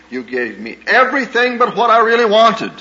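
high and loud, the hurt bursting out; bitter outcry. Retold or imitated. Daughter confronting her mother, high and loud. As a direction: fling the accusation at her.

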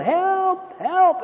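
high, strained cry of help from a cliff; stranded man yelling for help, pitched high; desperate yell for help. Retold or imitated. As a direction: call out for rescue with everything you have. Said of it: "he yelled".

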